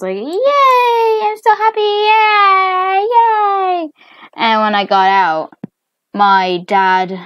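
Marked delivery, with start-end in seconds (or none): 0.2-3.9 very high, loud squeal of joy; over-the-top squeaky celebration; happy cheering. Acted. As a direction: cheer in long squealed vowels. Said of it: "I was happy".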